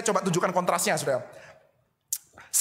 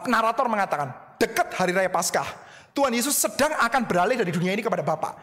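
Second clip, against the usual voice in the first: ordinary delivery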